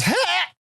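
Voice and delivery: sudden high yelp of fright; startled yelp. Retold or imitated. Soldier grabbed from behind yelps in fright. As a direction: yelp as you're yanked into the bush. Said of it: "all you got out of me was".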